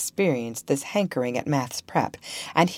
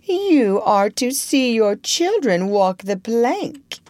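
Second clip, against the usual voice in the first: syrupy; 6 semitones higher, 4 dB louder, slower than usual. A narrator's reading. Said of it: "as if he spoke in syrup".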